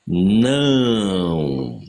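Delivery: ordinary talk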